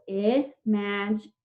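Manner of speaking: IMAGINATION split as ih, maj, in, ay, shun; five separate syllables. Shown as a demonstration. Teacher counting syllables for the class, IMAGINATION in separate pieces. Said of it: "There are five syllables".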